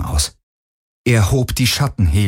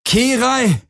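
high desperate shout; shouting a name. Acted. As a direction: call her name in panic. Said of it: "schrie er".

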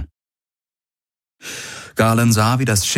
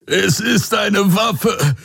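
high, slow, strained cry; agonised gasping; 14 semitones higher, much slower than usual. A narrator's reading. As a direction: gasp it out in torment. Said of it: "keuchte Hox gequält". (gequält keuchend)

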